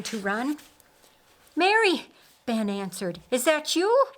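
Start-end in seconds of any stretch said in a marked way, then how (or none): 1.6-2.1 calls her name high and loud from a distance; calling out. A narrator's reading. Ben calling out to Mary. 3.3-4.1 calling out. A narrator's reading. Ben calling out to Mary.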